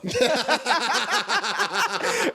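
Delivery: high burst of real laughter; loud laugh. Real, not acted. Comic laughing at his own heckler story, loud laugh. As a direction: crack up at the ending.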